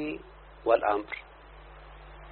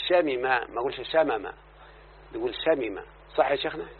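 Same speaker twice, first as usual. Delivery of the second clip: normal talking voice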